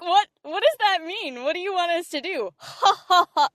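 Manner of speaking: high, incredulous retelling with a laugh; mock the odd direction, laughing; baffled laugh. Voice actors baffled at the direction, laughing. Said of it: "And we were like".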